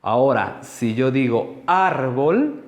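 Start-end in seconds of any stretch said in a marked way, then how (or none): 1.7-2.6 árbol with a long AR and rising end; stress demonstration. Shown as a demonstration. Teacher asking which sounds stronger, árbol drawn out on AR. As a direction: stretch the AR of árbol, lift the end. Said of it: "¿cuál suena más fuerte?".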